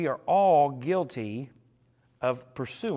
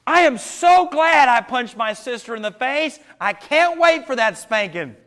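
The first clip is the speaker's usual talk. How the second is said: mock-cheerful kid voice, high and loud; a child pretending to be glad of a spanking; sarcastic kid; 9 semitones higher, 9 dB louder than usual. Preacher mocking a guilty kid, high and loud. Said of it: "Most kids are like".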